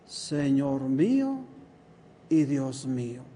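slow, low, drawn-out reverent words; low and slow, bowed by awe; overcome, humbled. Retold or imitated. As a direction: say it as if brought to your knees. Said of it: "Tuvieron que haber sido palabras muy doblegadas".

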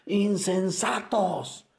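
high, slow shouted rebuke; gritando. Acted. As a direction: cry it out like a prophet scolding. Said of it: "Y Jesús grita una palabra". (grito de reproche, agudo y lento)

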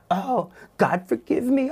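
mock the prayer in a high taunting whine; high taunting parody of a crying prayer. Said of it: "I began to hear a mocking voice".

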